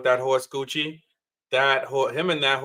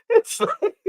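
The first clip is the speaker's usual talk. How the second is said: incredulous laugh. Real, not acted. Laughing in disbelief at him.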